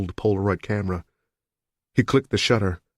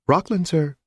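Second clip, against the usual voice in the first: lightly voiced polite operator, higher; a hint of a young telephone operator, higher; 9 semitones higher than usual. A narrator's reading. Operator answers the caller, lightly voiced and higher.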